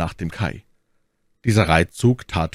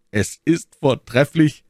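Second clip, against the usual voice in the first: high, loud, drawn-out exclamation; raised voice, stretched words; cheering approval; 10 semitones higher, 7 dB louder, slower than usual. A narrator's reading. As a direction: call it out slowly as if in chorus. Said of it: "riefen diese im Chor".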